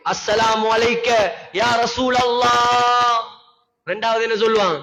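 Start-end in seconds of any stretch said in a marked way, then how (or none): 0.1-3.2 slow, drawn-out reverent call; solemn greeting. Acted. Pilgrims greeting the Prophet at his grave, solemn. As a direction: intone the salaam slowly.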